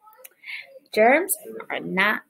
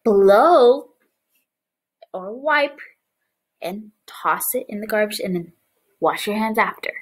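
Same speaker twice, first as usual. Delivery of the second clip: ordinary talk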